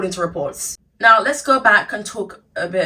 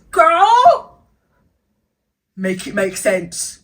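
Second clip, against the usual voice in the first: sassy; 5 dB louder, much slower than usual. Acted. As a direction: drawl out the exasperation. Sassy exasperated aside.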